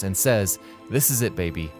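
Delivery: usual speaking voice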